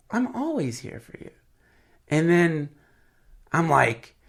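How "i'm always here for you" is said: higher, softer woman's voice; mock phone lady, high and soft. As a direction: voice a gentle woman on the line. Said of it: "And she goes".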